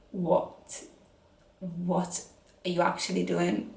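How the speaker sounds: lightly voiced dad, hushed disbelief; imitating dad. Dad reacting to her low step count, softly imitated disbelief. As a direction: quote a dad's baffled question, very softly. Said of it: "my dad was like".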